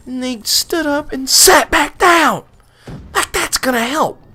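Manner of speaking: high, loud, incredulous rant; sarcastic near-shout; exasperated outburst. Real, not acted. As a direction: exclaim it, fed up and appalled. Exasperated at family sitting back down.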